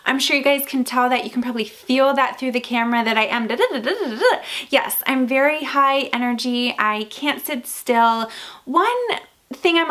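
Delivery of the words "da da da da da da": rapid sing-song string of da-da-da; hyper babble. Shown as a demonstration. Showing viewers her high energy, hyper babble. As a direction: babble da-da-da with pitch bouncing up and down.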